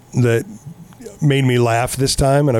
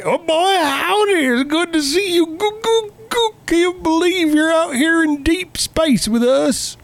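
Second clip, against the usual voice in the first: cheery high hayseed Southern robot; Slim Pickens voice; 13 semitones higher than usual. Retold or imitated. Podcaster imitates the film's robot Bob, high Southern twang. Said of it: "voiced by fucking Slim Pickens".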